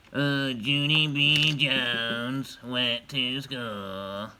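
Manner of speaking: slow, drawn-out silly grouch; grouchy voice. Shown as a demonstration. Parent showing kids silly reading voices, slow grouchy voice. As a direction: start loud and drag it out like a grouch. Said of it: "Or you can be a grouchy voice".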